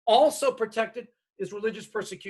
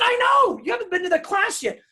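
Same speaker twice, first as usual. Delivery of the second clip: emphatic shout; 10 semitones higher, 4 dB louder than usual. Retold or imitated. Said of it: "I said".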